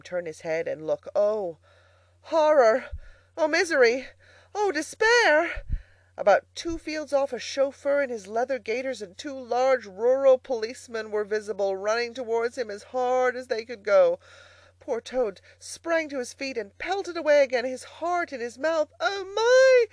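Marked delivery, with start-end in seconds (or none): 1.2-5.6 loud, raised cries of horror; melodramatic despair. A narrator's reading. Narrator voices Toad's horror at pursuers. 19.0-19.9 high, loud, tearful cry as Toad; panicked gasp. A narrator's reading. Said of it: "he gasped as he panted along".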